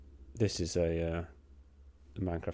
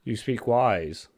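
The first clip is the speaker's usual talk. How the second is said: dragon voice; 5 semitones higher, 8 dB louder, much slower than usual. Acted.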